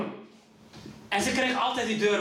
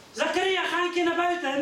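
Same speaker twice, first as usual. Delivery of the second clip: father calling; 6 semitones higher than usual. Retold or imitated. Father telling son go out.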